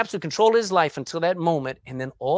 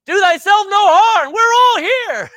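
high, loud cry out; 13 semitones higher, 12 dB louder than usual. Acted. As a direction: call out urgently across the jail. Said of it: "cried out".

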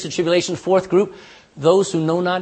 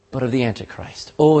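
normal talking voice

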